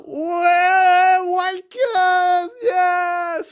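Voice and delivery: wild gleeful shouting; jubilant yelling. Retold or imitated. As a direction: cheer at the stopped terminal. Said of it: "And we're like".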